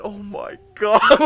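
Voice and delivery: loud, high, drawn-out disbelief; retold shock. Tech stopped dead by a patient's insult, mock shock. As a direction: reenact being floored, slow and loud.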